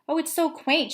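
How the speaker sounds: high, delighted gush over a charming town. Acted. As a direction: mom thrilled by the little town. Excited mom gushing.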